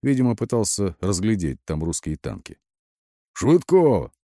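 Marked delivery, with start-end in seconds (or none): 3.3-4.1 raised, high-pitched summons; shouted call. A narrator's reading. Lieutenant summons his radio operator, shouting. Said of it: "крикнул немного опомнившийся старлей".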